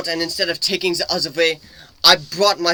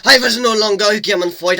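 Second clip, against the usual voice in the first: ordinary delivery, nothing marked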